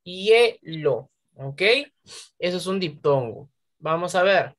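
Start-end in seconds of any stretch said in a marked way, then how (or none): 0.0-1.1 hielo split only as hie-lo; vowels kept together. Shown as a demonstration. Teacher applies diphthong rule, hielo broken into hie-lo. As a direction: say hielo in two beats, I and E joined. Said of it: "yo no puedo separar".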